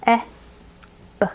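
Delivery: "Eh" and "B" as two isolated letter sounds; short "eh", pause, then a short "buh"; separate sounds. Shown as a demonstration. Teacher points and says the sounds first, separate sounds. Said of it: "say the sounds first".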